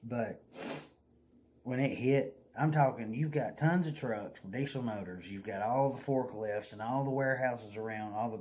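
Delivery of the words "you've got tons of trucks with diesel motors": normal talking voice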